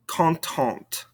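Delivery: "Contente" falling onto an audible final T; sounded ending consonants. Shown as a demonstration. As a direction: release the last T of "Contente". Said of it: "makes us pronounce those ending consonants".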